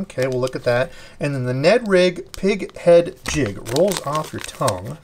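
usual speaking voice

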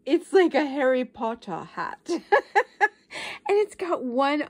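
ordinary talk

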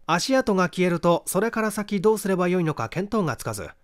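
ordinary delivery, nothing marked

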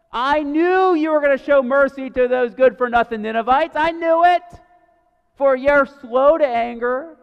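high, loud tantrum voice, stuttering I, I; whiny toddler. Retold or imitated. Jonah as a toddler complaining to God, high loud whining tantrum. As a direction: play Jonah as a toddler not getting his way. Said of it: "A toddler not getting his way".

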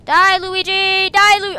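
high, loud, drawn-out yell; battle cry. Real, not acted. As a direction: yell it at your game foe. Battle cry at game foe.

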